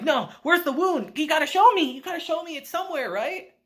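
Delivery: squeaky worried idiot hero; frantic dimwit. Retold or imitated. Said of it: "And Uncle's like".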